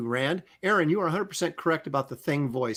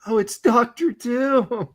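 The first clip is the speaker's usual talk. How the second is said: mock alarm; 7 semitones higher, slower than usual. Parodying Fantastic Four characters.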